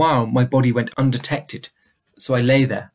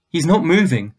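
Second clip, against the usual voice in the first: ordinary delivery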